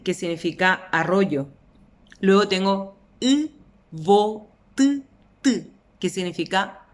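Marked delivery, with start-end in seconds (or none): 3.9-5.0 "uvotutu" con pausa entre sílabas: u, vo, tu, tu; silabeado. Shown as a demonstration. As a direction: dicta "uvotutu" sílaba por sílaba. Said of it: "la silabación es sencillísima". ("uvotutu" muy pausado)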